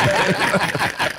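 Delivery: a high burst of real laughter; laughs. Real, not acted. Telling the host the story, laughing hard. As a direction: let the laugh break out. Laughing fit between repeated lines.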